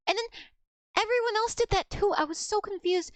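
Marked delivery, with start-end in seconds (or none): none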